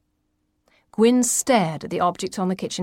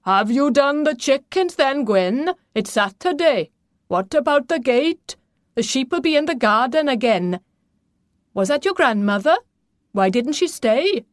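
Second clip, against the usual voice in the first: gruffly hectoring father, raised voice; roaring father; 6 semitones higher, 3 dB louder than usual. A narrator's reading. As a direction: fire questions up the stairs as a demanding father. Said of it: "His father's voice roared up the stairs".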